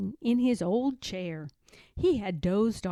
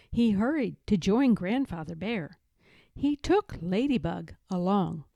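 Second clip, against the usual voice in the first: ordinary delivery, nothing marked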